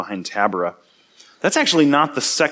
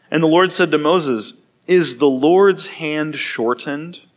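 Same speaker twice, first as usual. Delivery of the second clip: steady, measured Bible reading; scripture reading; 5 semitones higher, 5 dB louder than usual. Acted. Pastor reading Numbers to his church, measured and clear. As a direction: read the passage to the congregation.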